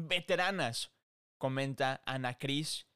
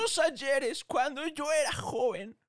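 put-on old man voice; 11 semitones higher, 4 dB louder, slower than usual. Continues the old man bit.

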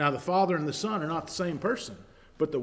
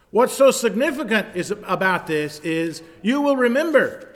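high, loud storytelling sermon; a preacher retelling Moses and the burning bush; preaching at full force; 8 semitones higher, 10 dB louder than usual. Acted. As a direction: carry the whole church along.

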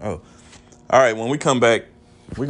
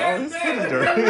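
laughing imitation; 11 semitones higher than usual. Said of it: "And she's like".

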